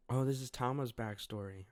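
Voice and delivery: ordinary delivery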